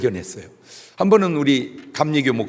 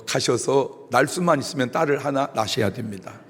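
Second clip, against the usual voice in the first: usual speaking voice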